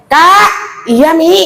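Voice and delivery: put-on a mother's sharp loud call to her child. Mother calling her daughter loudly, child answers loud too. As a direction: call Kak high and loud. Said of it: "beda dengan Abinya".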